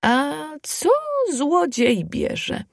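put-on high, hushed old granny question; a slow, lightly voiced granny question; old woman asking. A narrator's reading. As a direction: ask gently like an old wise woman. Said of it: "Aż spyta".

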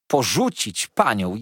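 slow, loud "Ja bym miała porzucić" in disbelief; a loyal maid's indignant outcry; indignant cry. A narrator's reading. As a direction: cry out, offended at the very idea. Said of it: "wołała poczciwa Peggotty".

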